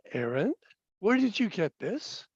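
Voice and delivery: soft, probing parental question; gentle questioning father. Retold or imitated. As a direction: quietly ask your child, tone rising at the end. Father's first, gentle question to son.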